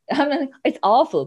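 usual speaking voice

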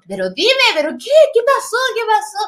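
high, insistent mock pleading; retold urgent questioning. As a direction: press her for an answer, pitch climbing. Retold urgent questioning of laughing mother. (preguntando con insistencia, voz aguda)

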